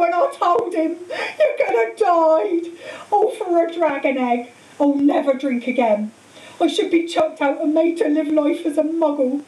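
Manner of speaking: high, distraught wail, acted; sobbing. A narrator's reading. Hagrid confessing he told about Fluffy, sobbing. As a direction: howl with remorse through acted tears. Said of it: "he sobbed, his face in his hands".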